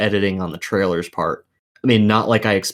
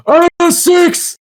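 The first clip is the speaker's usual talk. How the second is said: much higher, much louder, slow hammed-up yell; mock screaming bruiser; 8 dB louder, slower than usual. Mocking a movie cliche, the bruiser screams his catchphrase, hammed-up shout.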